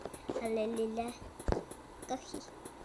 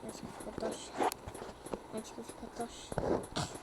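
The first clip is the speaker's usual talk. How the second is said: whispering; 3 semitones lower, 6 dB quieter than usual. Real, not acted. Sussurra procurando tocha.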